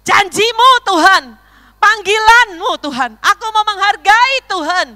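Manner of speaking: loud, high cries of surrender to God; impassioned high-pitched yelling to God; retold shout. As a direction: re-enact a fervent prayer at full voice. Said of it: "saya teriak".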